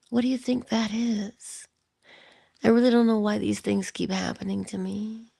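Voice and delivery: mock someone helplessly asking others for answers; puzzled advice-seeker.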